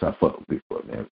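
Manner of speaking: wounded, nearly breathless admission; real hurt. Real, not acted. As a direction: let the disappointment drain the voice. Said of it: "y'all can hear it in my voice".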